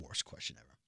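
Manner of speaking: whispered, breathless repeat; whispered echo. Real, not acted. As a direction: whisper it back in agreement. Echoing co-host in whisper.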